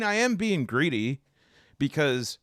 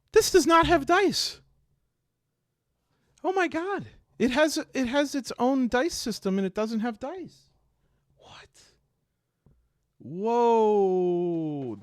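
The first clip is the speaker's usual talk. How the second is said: stunned disbelief; 8 semitones higher than usual. Real, not acted. Unboxing viewer finds no dice inside, high stunned disbelief. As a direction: gasp it out in disbelief as the box turns up empty.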